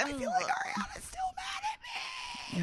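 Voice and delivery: hammed-up falsetto sob; mock crying. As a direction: wail it like a melodramatic crier. Mocking Sheena's crying.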